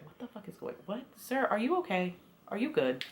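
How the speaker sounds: whispered what the fuck; breathy, baffled half-whisper; whispered disbelief. Retold or imitated. Her incredulous imagined reply.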